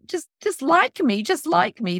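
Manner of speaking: high, drawn-out mock plea; half-voiced squeal of begging; mock scream. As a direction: imitate a desperate outburst without fully screaming. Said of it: "stand up and scream at the ballpark".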